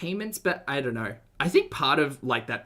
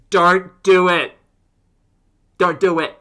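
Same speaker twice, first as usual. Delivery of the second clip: screaming brain cell; 6 semitones higher, 14 dB louder than usual. Retold or imitated. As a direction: scream a warning at yourself. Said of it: "single brain cell ... screaming".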